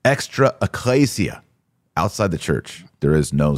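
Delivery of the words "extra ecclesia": ordinary talk, nothing marked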